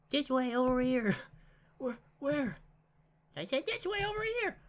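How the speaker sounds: put-on high, sweet girl voice; little sister voice. Mari calls from nowhere.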